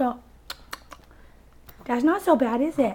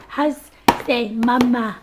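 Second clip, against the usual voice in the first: usual speaking voice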